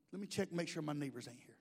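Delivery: low, hushed, quick aside; confidential aside. Pretend. Pastor checking his neighbors aren't there, hushed aside. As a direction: drop it as if neighbors might overhear.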